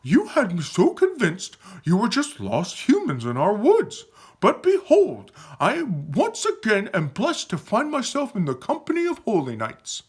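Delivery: high, excited, exalted voice; awestruck Aaron. Acted. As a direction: proclaim it with reverent excitement. Aaron acted, in awe of knights.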